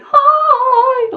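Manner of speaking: very high, loud squeaky voice. Shown as a demonstration. Teacher showing students a high voice, loud squeak. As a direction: jump up to a squeak. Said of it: "like that".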